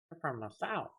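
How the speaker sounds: high, soft mocking quote; light, high-voiced Northerner impression; mimicked Northerner. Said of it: "When I go up north, they're like".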